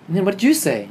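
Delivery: loud, high, urgent question; acted-out incredulous questioning; astonished prompting. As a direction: press your brother for his answer. Retold eager questioning of brother.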